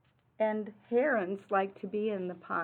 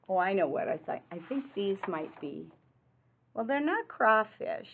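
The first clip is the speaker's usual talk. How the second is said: ordinary delivery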